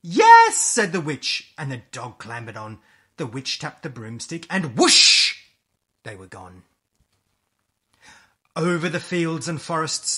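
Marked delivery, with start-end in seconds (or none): 0.0-0.6 high, loud, gleeful "yes"; delighted witch's cry; excited yes. Acted. Witch answers the animals gladly. 4.8-5.4 loud, rushing "whoosh"; explosive storybook sound effect; broomstick whoosh. A narrator's reading. Storyteller imitates broom taking off.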